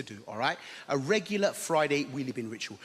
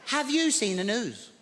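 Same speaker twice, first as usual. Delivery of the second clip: high, fretful Birmingham neighbour; lightly voiced flustered old lady; high, slow worried neighbour; Maud's voice; 6 semitones higher, slower than usual. Maud voiced in story.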